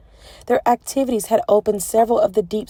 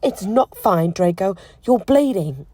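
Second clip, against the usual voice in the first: upset insistence; 5 dB louder than usual. A narrator's reading. Hermione upset at blood.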